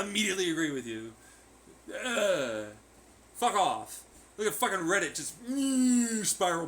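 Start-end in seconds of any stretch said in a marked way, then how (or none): none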